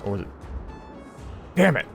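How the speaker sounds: ordinary talk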